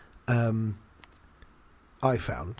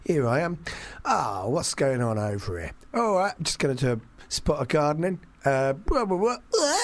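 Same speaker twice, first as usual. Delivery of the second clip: imitating a casual everyday bloke, dropped T's and H's; lightly voiced everyday bloke, dropping T's and H's; dropping word endings; 3 semitones higher than usual. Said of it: "plays him as sort of, like, an everyday man. Drops his T's and H's".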